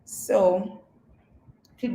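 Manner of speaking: ordinary talk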